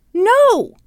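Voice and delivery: acted-out shout, high and loud; angry refusal. Father refusing the accusing woman, angry.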